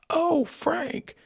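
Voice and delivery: very high-pitched startled mock yell; retold yell. Replaying his yell on the recording, high mock yell. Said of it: "then you hear me yell".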